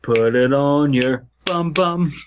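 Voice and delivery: ordinary talk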